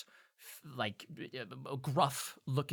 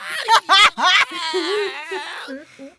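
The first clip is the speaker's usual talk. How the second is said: squeaky imp laugh; 13 dB louder than usual. Acted.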